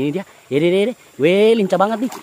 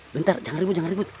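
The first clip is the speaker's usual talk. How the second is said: much softer, keeping it down; hushed; 8 dB quieter, much faster than usual. Real, not acted. Vlogger hushing viewers while stalking fish: soft, hushed. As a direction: hush so the fish won't flee.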